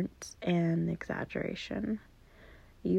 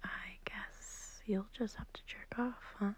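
hushed whisper, much softer; 5 dB quieter than usual. Acted. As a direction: whisper so the sleeper beside you doesn't wake. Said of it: "I'm whispering because I don't want to wake up".

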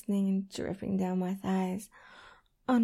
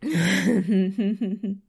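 louder spent giggle; breathless laugh; 4 semitones lower, 9 dB louder than usual. Acted. Lover laughing after climax, breathless giggle. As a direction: laugh it off, satisfied.

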